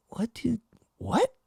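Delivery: high, soft confused stammer; stunned by the mentor's question; baffled squeak. Retold or imitated. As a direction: trail off, lost for words. Confused retort, high and quiet.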